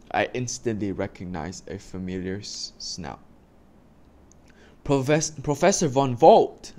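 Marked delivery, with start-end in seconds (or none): 4.8-6.7 high, loud delighted greeting; excited exclaim. Acted. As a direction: call out in glad surprise. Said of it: "I exclaimed".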